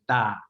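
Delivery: "da" open, with dropped jaw and unaspirated onset; jaw pulled down. Shown as a demonstration. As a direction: pull the jaw down for "da", between D and T. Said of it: "You pull your jaw down".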